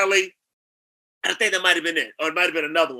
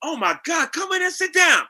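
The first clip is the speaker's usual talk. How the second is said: excited welcome; 10 semitones higher than usual. Retold or imitated. Casting director welcomes him in.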